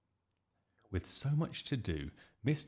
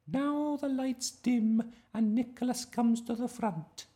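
slow, high, loud schoolmistress; teacher directing the play; 6 dB louder, slower than usual. A narrator's reading. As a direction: announce the stage direction slowly in a high voice. Teacher directing the nativity rehearsal.

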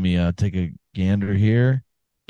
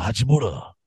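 Japanese flair. Pretend. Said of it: "more of a Japanese flair".

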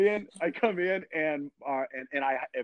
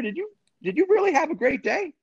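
skeptical official; 14 semitones higher than usual. Retold or imitated. Official doubting the rider's day.

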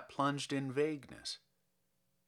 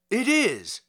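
loud, high eager reply; eager shout; 7 semitones higher, 8 dB louder than usual. A narrator's reading. Kovalev shouting his answer to the stranger at the door. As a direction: call it out, jumping up. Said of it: "Kovalev shouted".

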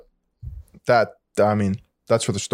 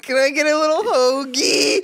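high-pitched, dainty mock voice; mocking hoagie order; 11 semitones higher than usual. Co-host joining in to mock hoagie orders, high and silly. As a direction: mimic a silly customer, pitched way up.

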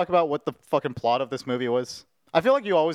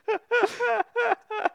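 high giggle. Real, not acted. Laughing at his own photo.

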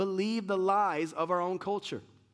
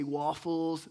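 waffles in a drawn-out American accent; 2 semitones lower than usual. Shown as a demonstration. Preacher showing how Americans sound, American accent on waffles. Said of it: "You talk like this".

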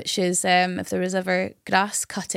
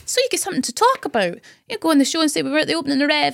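coaxing plea; 6 semitones higher, much faster than usual. Retold or imitated. Pleading with Gino to come.

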